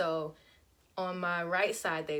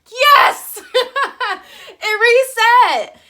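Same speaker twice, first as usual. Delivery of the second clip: much higher, much louder cheer with a laugh; joyful yell; 13 semitones higher, 17 dB louder, much slower than usual. Retold or imitated. Celebrating when the blinker finally turns off, shrieking. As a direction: burst out in triumph, then slow down.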